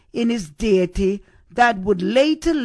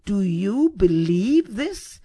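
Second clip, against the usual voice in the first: slow question, "believe" drawn out and emphasized; "Do you BELIEVE this?", asked slowly and deliberately; stress on BELIEVE; 2 semitones lower than usual. Acted. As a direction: lean on the word "believe". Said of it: "he emphasized the word belief".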